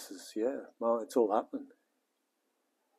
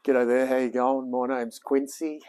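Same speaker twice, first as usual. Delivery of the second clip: presenter intro; 2 semitones higher, 4 dB louder than usual. Real, not acted.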